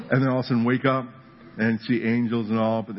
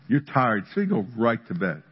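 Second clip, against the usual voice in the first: usual speaking voice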